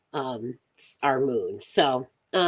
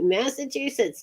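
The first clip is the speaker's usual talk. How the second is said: higher, put-on Massachusetts accent; 8 semitones higher than usual. Shown as a demonstration. Host attempting the Northeast, amused Massachusetts accent. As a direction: say "Massachusetts" in a rough New England accent. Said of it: "I'm not real great with the Northeast".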